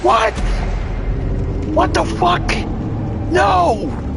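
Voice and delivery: shocked yelling. Real, not acted. Gamer yelling at the screen after a shock, in high disbelief. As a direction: scream it out, stunned. Sudden shock in boss fight.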